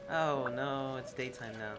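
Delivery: usual speaking voice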